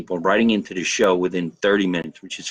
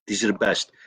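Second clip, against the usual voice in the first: ordinary talk, nothing marked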